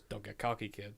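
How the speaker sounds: deadpan Han Solo. Retold or imitated. Wonder Woman quoting Han Solo to camera, low dry deadpan. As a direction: say it low and cool like a smuggler. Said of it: "then goes".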